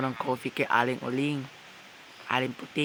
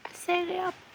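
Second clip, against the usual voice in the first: put-on old woman voice. Aling Uling says goodbye.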